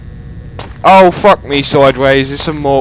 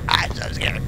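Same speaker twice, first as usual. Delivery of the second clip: whispered, breathy and unvoiced; whispering; 11 dB quieter than usual. Shown as a demonstration. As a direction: whisper it, scared and hushed.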